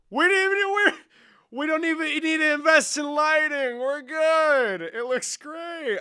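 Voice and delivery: high, loud thrilled voice; excited. Real, not acted. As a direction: gush with delight over the picture. Thrilled by camera quality.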